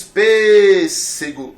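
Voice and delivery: "pêssego" with PÊS loud and long drawn out; sílaba tônica exagerada. Shown as a demonstration. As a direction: stretch and punch the first syllable of "pêssego". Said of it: "Está na primeira sílaba, você diz". ("pêssego" com o PÊS forte e alongado)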